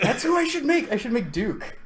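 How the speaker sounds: high, eager idea; excited. Real, not acted. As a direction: blurt it out with excitement.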